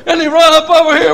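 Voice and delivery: loud, high revival storytelling; fired-up country preacher; full-force preaching. Real, not acted. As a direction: holler the tale out, pitched high.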